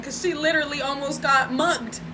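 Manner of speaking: usual speaking voice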